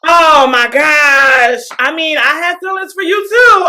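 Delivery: loud, drawn-out mock delight; hammed-up gushing. [name] returning Madison's confession, hammed-up gushing.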